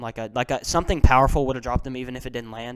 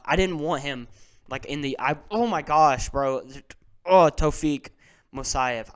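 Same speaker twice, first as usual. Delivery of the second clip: usual speaking voice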